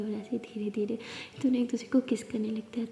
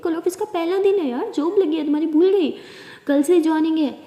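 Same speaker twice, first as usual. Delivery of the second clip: higher, louder eager nudge; can't believe you forgot; excited reminder; 5 semitones higher, 8 dB louder than usual. Acted. Partner reminds wife her new job starts tomorrow, excited and loud.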